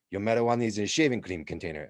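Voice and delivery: fast, insistent mock Italian cop; Italian accent. Officer sure the pot is in the shaving cream, mock Italian accent. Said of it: "thick Italian accents".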